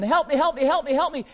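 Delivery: mock terrified scream. Preacher imitates a victim begging bystanders, mock panicked scream. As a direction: imitate a frightened woman screaming for help. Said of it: "she's screaming".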